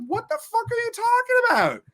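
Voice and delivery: very high, hollering through laughter; laughing disbelief. Retold or imitated. Friend scream-laughing at the narrator, high hollering disbelief. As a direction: squeal it while cracking up. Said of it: "she's just like".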